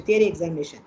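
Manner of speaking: ordinary talk, nothing marked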